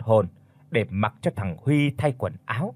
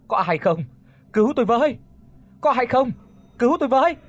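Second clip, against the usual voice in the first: high, loud, urgent calls; crying for help; 11 semitones higher, 5 dB louder, faster than usual. A narrator's reading. Unseen woman calling across a night field for help, desperate cry. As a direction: call out for rescue, climbing much higher. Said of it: "tiếng nói của ai đó kêu cứu".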